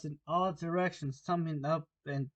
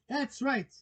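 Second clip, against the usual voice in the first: high, mildly raised call; shouted approval; 10 semitones higher, 3 dB louder than usual. Acted. Queen approving the soldiers' report, mild shout. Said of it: "shouted the Queen".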